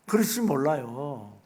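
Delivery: ordinary delivery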